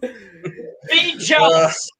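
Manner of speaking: slow laughing taunt; gloating laugh. Real, not acted. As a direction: draw it out, laughing. Gloating over pick.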